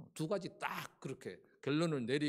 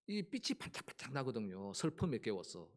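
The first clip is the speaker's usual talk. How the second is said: ordinary delivery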